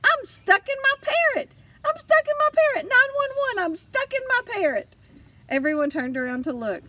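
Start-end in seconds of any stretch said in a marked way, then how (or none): 0.0-4.9 much higher, louder frantic yelling; little girl shrieking that she is stuck in her swim ring; panicked shout. A narrator's reading. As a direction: scream it out in a panic, like a trapped child. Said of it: "I panicked and shouted".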